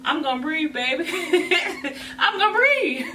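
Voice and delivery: laughing, high and loud insistence. Real, not acted. To her viewers about shapewear, laughing and loud. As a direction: laugh it out and insist she'll breathe.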